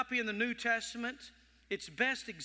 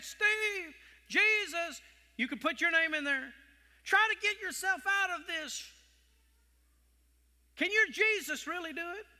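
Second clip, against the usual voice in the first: mocking crowd; 7 semitones higher than usual. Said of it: "all of them were constantly saying".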